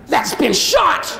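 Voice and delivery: slow, high shocked exclamation; shocked discovery. Retold or imitated. Wife spotting the bullet hole, slow and high shocked. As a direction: gasp it out in outrage.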